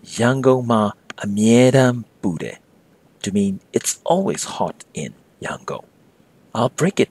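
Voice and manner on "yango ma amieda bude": slow teaching read. Shown as a demonstration. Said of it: "you can say".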